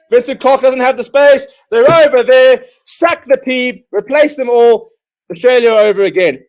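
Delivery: loud, high exasperated fan; exasperated shouting. Real, not acted. Fan shouting at his own team, loud and exasperated. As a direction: yell it out in disbelief at the team.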